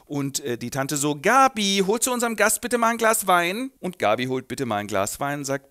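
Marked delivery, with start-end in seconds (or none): 1.2-3.7 bossy aunt. Acted. Aunt gives an order.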